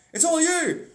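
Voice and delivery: high, loud retold shout; neighbor shouting. Neighbor calls out to him, a loud high shout. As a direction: yell it across the street. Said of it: "neighbor shouts".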